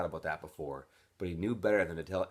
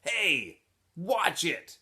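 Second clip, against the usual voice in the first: higher, louder gruff bully voice; brusque bully snapping at a stranger; tough kid's warning; 9 semitones higher, 5 dB louder, much slower than usual. A narrator's reading. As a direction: bark it like a big tough kid. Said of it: "said an orange lump on a chair".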